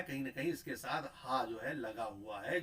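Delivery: usual speaking voice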